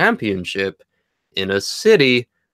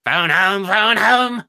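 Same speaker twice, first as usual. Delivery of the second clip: higher croaky alien plea; E T impression; 8 semitones higher, slower than usual. Quoting E T.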